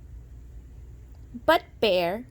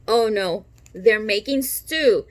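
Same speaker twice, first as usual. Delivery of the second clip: plain reading voice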